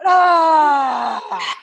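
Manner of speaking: shouted breath-out ah; loud exhale ah. Shown as a demonstration. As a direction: let the air go with a big ah. Final big exhale.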